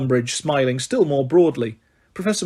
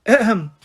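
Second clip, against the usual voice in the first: high, prissy little ahem; fussy throat-clear; 6 semitones higher than usual. Acted. Umbridge about to read the decree, fussy ahem. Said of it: "clearing her throat fussily".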